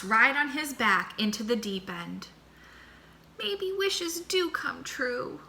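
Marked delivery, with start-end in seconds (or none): 3.4-5.4 high, slow and soft; happy thought. Acted. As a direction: muse it dreamily and gently. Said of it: "Armadillo thought happily".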